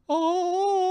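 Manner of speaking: long, high held "ooh"; awed rapturous "oh". Acted. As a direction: hold a high note of holy rapture. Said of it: "he felt like the popiness coming to him".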